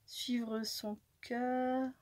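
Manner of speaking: ordinary talk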